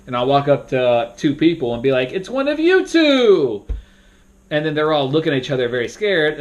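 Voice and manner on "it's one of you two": high, slow, loud suspense; dramatic fake-out. Shown as a demonstration. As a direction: stretch it out to scare the two players. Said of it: "sometimes I might trick the whole group".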